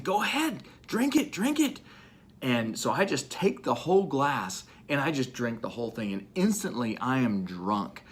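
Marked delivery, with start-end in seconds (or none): 0.0-1.8 much higher, bouncing with glee; excited Jesus. Retold or imitated. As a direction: coax him to drink, giddy with joy. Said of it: "laughing and excited".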